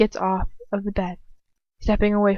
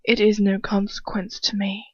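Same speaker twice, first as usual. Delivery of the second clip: plain reading voice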